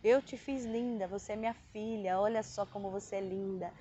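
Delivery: low, soft fatherly reassurance; tender voice of God. Retold or imitated. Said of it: "Aí Deus falou". (voz de Deus, baixa, suave e carinhosa)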